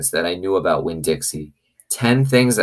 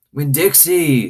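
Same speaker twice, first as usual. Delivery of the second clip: shouted; 9 semitones higher, 3 dB louder, slower than usual. Acted. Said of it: "the preacher shouted".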